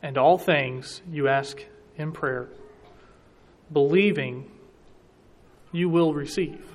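slow, with a clear pause before and after "believing"; pauses around believing. Shown as a demonstration. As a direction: set "believing" apart with a pause on each side. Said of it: "there's two commas in this sentence, right around believing".

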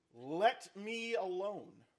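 slow, drawn-out divine warning; God's warning. Acted. Preacher echoing God's words to Moses, slow and ominous. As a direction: let each word hang like a threat.

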